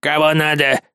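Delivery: nasty voice spitting the words; rough guard voice. A narrator's reading. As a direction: spit it out like a rude doorman. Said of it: "противный, грубый голос выплюнул слова".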